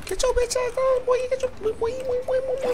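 mock taunt. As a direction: tease the enemy in a silly high squeal. Taunting a game enemy.

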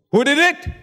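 high, loud demanding question; rhetorical challenge. Real, not acted. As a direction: throw the question at the crowd.

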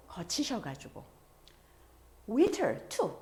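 ordinary talk

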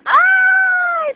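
sudden high shriek of pain; pained yelp. Real, not acted. Owner shrieking when the parrot bites her.

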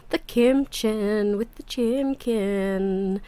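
slow, cutesy "kimchen" and "chimkin" with m for t and ck; playful baby talk. Pretend. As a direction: savour "The kimchen with the chimkin" slowly like a toddler.

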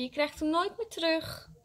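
high-pitched gloating refusal; childish taunt. A narrator's reading. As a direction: rub it in like a child. Boy taunts the crying child.

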